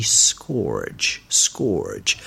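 scourge said deliberately as SKORJ; slow model scourge with an OR vowel; correct pronunciation. Shown as a demonstration. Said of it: "note how you pronounce that".

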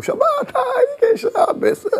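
very high, slow rapturous call; ecstatic cry. Acted. Dancing man cries out.

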